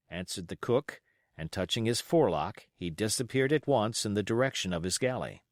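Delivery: usual speaking voice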